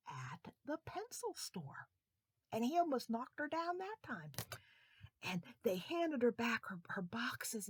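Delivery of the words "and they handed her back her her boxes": ordinary delivery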